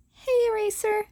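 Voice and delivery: bright high call across the rooftop. Acted. Teen hails the pro hero across the roof, calling out.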